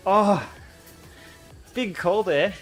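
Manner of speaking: ordinary talk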